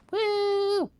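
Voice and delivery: a happy high-pitched whoop; high woo. Real, not acted. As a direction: squeal a playful woo. Playful cheer, very high.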